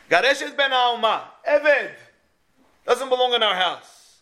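half-acted Sarah sending the servant's son away; stern dismissal. Sarah Imenu ordering Yishmael out of the house, in a lightly voiced stern tone.

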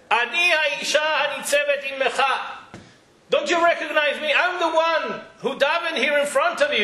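a mother pleading for her son's life; desperate plea. Retold or imitated. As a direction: beg him not to kill your child. Hannah pleading with Eli.

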